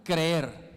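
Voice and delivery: "creer" said loud and stretched, falling; emphatic creer. Shown as a demonstration. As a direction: lean hard on "creer" and hold the long e.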